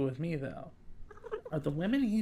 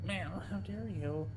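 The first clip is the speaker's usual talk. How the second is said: voicing the cat; 3 semitones higher, 9 dB quieter, slower than usual. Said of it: "Like, meow".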